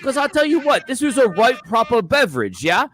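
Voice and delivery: high, loud British accent. Shown as a demonstration. Drew reviews his beer in character, British accent. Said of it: "I can do a British accent".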